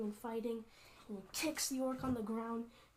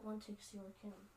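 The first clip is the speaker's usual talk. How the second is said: normal talking voice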